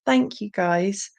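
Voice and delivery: ordinary talk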